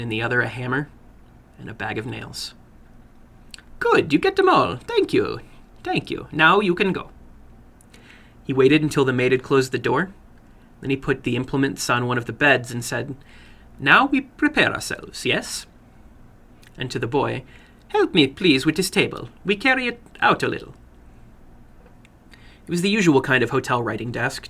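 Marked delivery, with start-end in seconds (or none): 3.8-7.1 higher, eager voice of a little foreign man; a little man running his game with relish. Acted. 13.8-20.7 higher, eager voice of a little foreign man; a little man running his game with relish. Acted.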